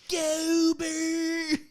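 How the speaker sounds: put-on high Philly accent shout, laughing. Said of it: "With the hardcore Philly accent".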